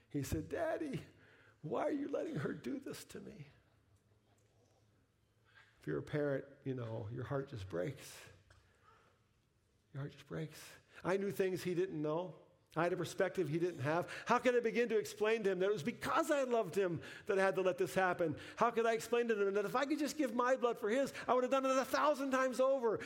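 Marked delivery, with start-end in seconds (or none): 0.5-3.5 very high, small imitated child's plea; tearful little boy. Four-year-old son asks dad about the needle, imitated tearful plea. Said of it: "with his big eyes filling with tears". 14.3-23.0 high, urgent outpouring of a father; impassioned anguish. Retold or imitated. Father wishing he could explain to his son, impassioned.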